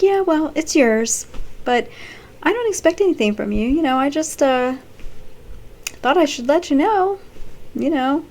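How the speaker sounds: high, put-on casual Lisa voice; breezy confession. Lisa tells Nate the baby is his, breezy and high. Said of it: "She's like".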